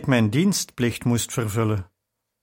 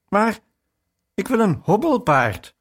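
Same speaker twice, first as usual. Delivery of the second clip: higher and a little louder, a touch of a whining sob; mock tearful cry; 8 semitones higher, 3 dB louder than usual. Boy pleading with mother after she refuses, mildly tearful. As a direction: plead like a small child about to cry. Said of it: "riep ik huilend uit".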